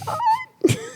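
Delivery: high wheezing giggle; high-pitched silent laugh; high breathy laugh. Real, not acted. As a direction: break into a squeaky breathless laugh. Laughing at her own story.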